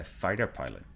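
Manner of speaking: ordinary talk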